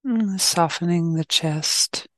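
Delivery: calm, unhurried meditation guidance; short, even phrases with long silences between; guided meditation. Real, not acted.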